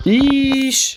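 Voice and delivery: long, high-pitched "eesh"; startled high-pitched wince; high squeal. Real, not acted. Startled reaction to game.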